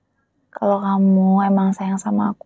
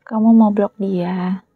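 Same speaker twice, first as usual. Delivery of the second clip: ordinary delivery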